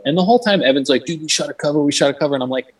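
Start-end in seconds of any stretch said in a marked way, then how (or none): 1.0-2.3 higher-pitched, insistent mock excitement; retold hype, pitched up; excited friend. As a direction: imitate an excited buddy repeating himself. Imitating friend insisting about cover.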